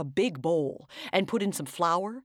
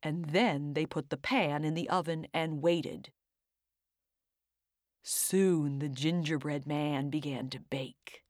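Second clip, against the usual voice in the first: ordinary delivery, nothing marked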